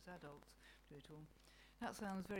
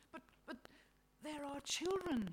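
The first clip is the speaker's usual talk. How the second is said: aghast; 9 semitones higher, 7 dB louder than usual. A narrator's reading. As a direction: plead for them in shocked disbelief. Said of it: "God is aghast".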